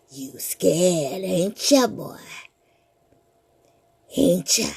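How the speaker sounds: low mocking ghoul voice; deep slow teasing menace; monster taunt. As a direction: taunt the scared boy.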